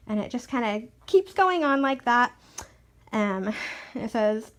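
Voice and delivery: ordinary delivery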